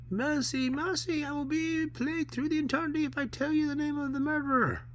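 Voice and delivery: very high, wailing specter; pleading ghost. Acted. Specter begging mercy of the man, high and wailing. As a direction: wail for mercy as a frightened ghost.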